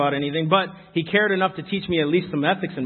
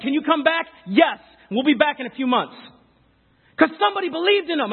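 raised, fervent preaching voice; 7 semitones higher than usual. Acted. A preacher urges his congregation, fervent raised preaching. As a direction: preach it up with rising conviction. Preaching builds over kids' story.

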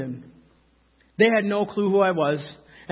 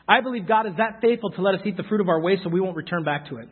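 normal talking voice

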